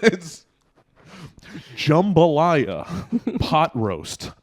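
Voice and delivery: slow, deliberate vocabulary-tape delivery; mock instructional-tape voice; English lesson voice. Shown as a demonstration. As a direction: read out example words for language learners. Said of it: "You sound like every example voice in our English lessons".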